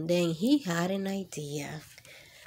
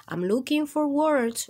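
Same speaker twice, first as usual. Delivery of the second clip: announcing; 7 semitones higher, 4 dB louder than usual. Acted. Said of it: "Rocket will announce".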